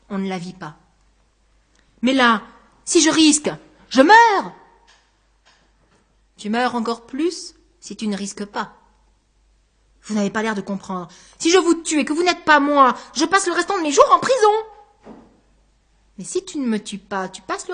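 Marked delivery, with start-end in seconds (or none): 2.0-4.5 rising, louder and higher to the end; alarmed protest. Acted. 11.4-15.1 higher and louder, pressing; agitated argument. Acted. Anguste argues, agitated.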